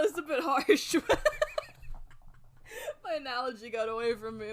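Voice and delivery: breaking up into real laughter mid-rant; laughing rant. Real, not acted. Gamer ranting about a game's troubled development, cracking up.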